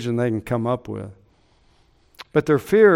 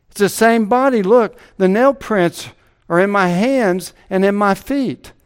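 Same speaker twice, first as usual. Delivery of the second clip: high, loud, rising to a pulpit climax; preaching at full force; 6 semitones higher, 6 dB louder than usual. Acted. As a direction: drive the proof home to the congregation.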